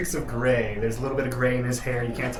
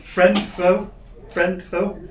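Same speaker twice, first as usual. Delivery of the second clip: wary NPC; 6 semitones higher, 6 dB louder, much slower than usual. Acted. NPC asks, high and loud.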